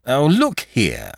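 slow, exasperated "Oh, look here" in a stiff magistrate voice; a lightly voiced irritated judge; exclaimed protest. A narrator's reading. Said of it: "exclaimed Monsieur Filleul".

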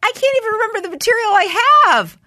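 high, loud, incredulous protest; exasperated outburst. Retold or imitated. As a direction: yell back in hammed-up desperation. Acts out yelling back at producer.

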